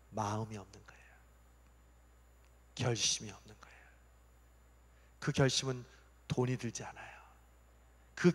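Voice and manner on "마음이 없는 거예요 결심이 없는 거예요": whispered, much softer; hushed point. Real, not acted. Pastor tells the congregation what they lack, in a whisper. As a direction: drop to a whisper to land it.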